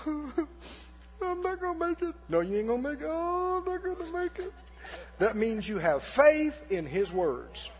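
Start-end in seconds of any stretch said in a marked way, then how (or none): 0.1-2.1 mock wail. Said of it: "you go". 2.3-3.1 imitated taunter. Devil answers the mock wailer. 3.1-4.5 mock wail. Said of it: "you go".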